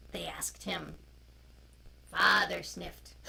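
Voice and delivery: loud, slow storybook reading; reading to children. A narrator's reading. Storyteller reading a picture book to children online. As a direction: read it out to the little ones, clearly.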